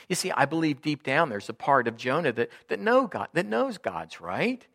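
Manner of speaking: usual speaking voice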